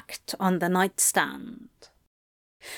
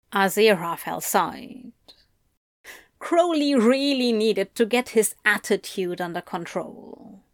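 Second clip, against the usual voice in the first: ordinary delivery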